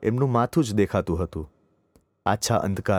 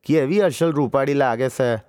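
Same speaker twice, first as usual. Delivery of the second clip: put-on higher, louder gloating old aunt; pleased old aunt; 5 semitones higher, 3 dB louder than usual. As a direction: coax the boy, pleased with herself. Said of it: "હસ્યા ને બોલ્યા".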